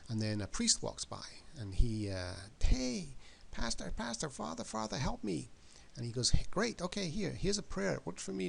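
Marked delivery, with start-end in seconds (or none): 2.6-5.5 much higher, louder frantic calling out; desperate call for help. Acted. Man stuck in a grave begs a passing priest, frantic high call. 6.3-8.5 high, bright-voiced chirpy priest; breezy priest. Priest answers the man in the grave, high cheery brush-off.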